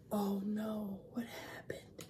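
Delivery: soft whispered worry; hushed dismay. Acted. Reader whispering to kids as cupcakes vanish. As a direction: breathe it out in dismay.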